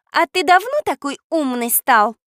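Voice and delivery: caustic jibe, high and loud; sarcastic. A narrator's reading. Girl mocks the boy's good advice, caustic. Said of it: "язвительно спросила Катя".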